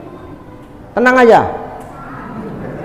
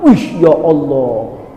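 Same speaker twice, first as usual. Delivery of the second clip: surprised exclamation; 3 semitones lower, 6 dB quieter, slower than usual. Retold or imitated. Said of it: "ditengok di neraka".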